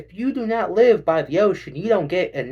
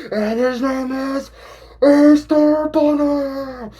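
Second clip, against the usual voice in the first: high, loud, slow drawn-out intro; wrestling announcer; 12 semitones higher, 8 dB louder, much slower than usual. Pretend. Ring announcer introducing the Easter Bunny, loud and drawn out. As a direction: boom the name like a ring announcer.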